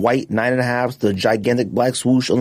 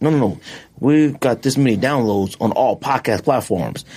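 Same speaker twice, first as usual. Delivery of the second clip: ordinary delivery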